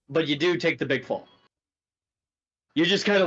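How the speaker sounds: ordinary talk, nothing marked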